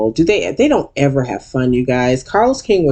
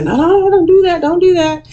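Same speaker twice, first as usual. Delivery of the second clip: everyone pleading; 14 semitones higher than usual. Retold or imitated. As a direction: cry out high to stop the fight. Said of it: "And everyone's like".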